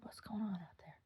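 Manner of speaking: whispered question, barely voiced. Whispering to Omar at night about the lights. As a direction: hush it like it's the middle of the night. Quoting herself waking husband.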